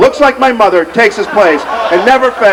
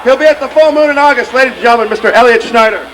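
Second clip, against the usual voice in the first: higher-pitched MC introduction; stage announcer; 6 semitones higher than usual. Real, not acted. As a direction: announce the next act to the room. Introduces the first act.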